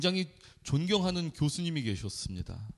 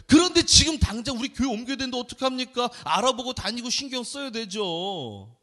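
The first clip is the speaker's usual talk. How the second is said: retold protest; 6 semitones higher, 4 dB louder than usual. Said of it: "제가 그날은 그랬어요".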